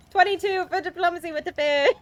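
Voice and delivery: high, loud, thrilled squeal; excited announcement. Real, not acted. Player announcing a diplomacy roll to the game master, excited.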